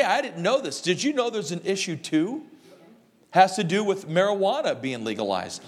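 ordinary talk, nothing marked

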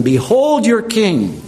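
mock them with the announcement. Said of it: "and so he mocks them".

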